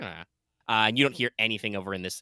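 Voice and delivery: usual speaking voice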